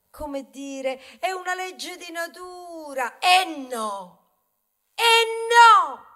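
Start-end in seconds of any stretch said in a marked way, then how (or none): 3.2-6.1 louder, rising protest; building up a no to a cry; indignant protest. Acted. The wheat objects to humans, rising indignant cry.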